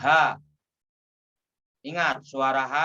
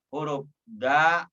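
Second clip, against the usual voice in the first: usual speaking voice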